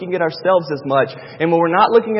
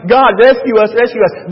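loud, higher pleading to God; imitated crying out; 4 semitones higher, 6 dB louder than usual. Israelites crying to God in Egypt, imitated crying out. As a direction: cry out for rescue, raised and urgent. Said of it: "They were crying and crying and crying".

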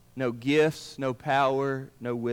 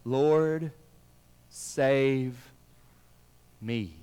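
slow, halting plea, fading away word by word; drawn-out plea; 3 semitones lower, much slower than usual. Acted. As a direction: let each word hang, sinking. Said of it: "he cried out".

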